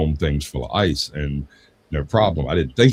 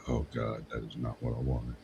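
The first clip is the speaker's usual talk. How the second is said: dismayed aside; 7 semitones lower, 15 dB quieter than usual. Retold or imitated. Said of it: "And I'm like".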